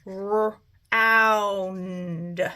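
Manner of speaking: "r-, ound" split, ow stretched; sounded out. Shown as a demonstration. Teacher to class, sounding out "r-, ound". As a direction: break off "r-" then a long "ound". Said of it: "So I've got my uh".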